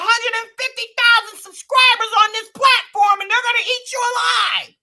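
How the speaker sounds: high, loud hammed-up holler; over-the-top shrieked warning; mock yelled warning. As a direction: yell it way up high, theatrically. Mock-alarmed warning at stretch start.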